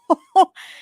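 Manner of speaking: short high, loud burst of laughter; a quick, high whoop of a laugh; delighted laugh. Real, not acted. Host reacting to a fan's ramen question, delighted laugh.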